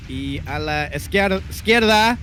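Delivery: high, slow Spanish announcer voice; lucha libre announcer. Acted. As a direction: call the map like a wrestling announcer in Spanish. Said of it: "should we go in Spanish this one".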